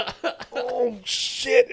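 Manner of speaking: high laughing cry of shock at the blood; shocked yelp. Retold or imitated. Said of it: "and I'm just like".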